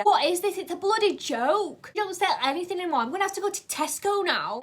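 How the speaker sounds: high, loud hammed-up rant; huffy customer complaint, hammed up; mock outraged customer. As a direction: play the indignant shopper. Ranting customer voiced.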